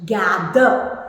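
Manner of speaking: "Gather" said as a careful model word; "Gather" pronounced deliberately for learners; careful pronunciation. Shown as a demonstration. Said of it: "So pronounce the words properly".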